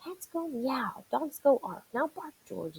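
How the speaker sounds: high, patient but fed-up mother scolding her pup; exasperated mom. Acted. Mother scolding her puppy George, exasperated and high. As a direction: scold gently like a tired mom. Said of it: "I could hear her saying".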